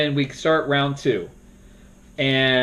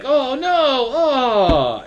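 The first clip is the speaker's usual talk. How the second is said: 1 imitating dismay; 11 semitones higher, much slower than usual. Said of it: "everyone would be like".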